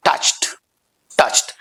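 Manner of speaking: touched in one syllable, "tucht"; right pronunciation. Shown as a demonstration. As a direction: end touched on a crisp t. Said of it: "right pronunciation would be". (touched को "टच्ट" जैसा बोलें)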